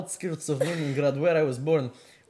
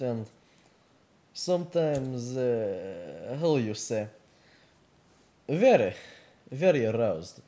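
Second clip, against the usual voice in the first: put-on Russian accent with halting delivery; 5 semitones lower, slower than usual. Podcast host welcoming listeners as Vladimir, Russian accent. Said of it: "gonna do this accent the entire time".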